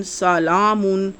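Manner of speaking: سلامن said slowly with its long آ held twice as long; slow recitation of سلامن; drawn-out vowel. Shown as a demonstration. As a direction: hold the لا in سلامن for double length. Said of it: "دگنا لمبا کر کے پڑھتے ہیں".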